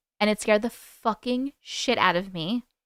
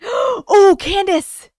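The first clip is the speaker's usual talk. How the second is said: loud, high startled exclamation; gasp, then cry out the name; gasping surprise; 7 semitones higher, 7 dB louder, much slower than usual. Real, not acted. Gasps on finding the story.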